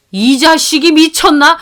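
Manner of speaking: put-on an old father boiling over; enraged father. Father-in-law rages about his cheating son.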